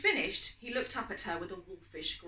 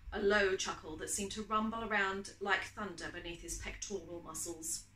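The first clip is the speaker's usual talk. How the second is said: ordinary delivery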